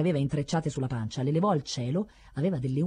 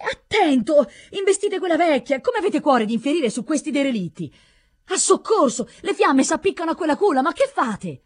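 loud, high, urgent cries of alarm; frantic appeals, pitched well up; horrified shouting; 9 semitones higher, 6 dB louder than usual. A narrator's reading. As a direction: shout warnings and outraged protests. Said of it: "gridava all'anziano".

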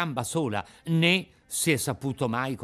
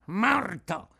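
menacing echo; 6 semitones higher than usual. Acted. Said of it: "ripeté l'altro".

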